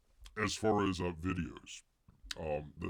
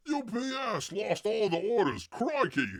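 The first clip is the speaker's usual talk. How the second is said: high, loud put-on Aussie accent; hammed-up Aussie complaint, pitched way up; mock Australian outburst; 10 semitones higher, 4 dB louder than usual. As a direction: cry out like a hammed-up, exasperated Australian.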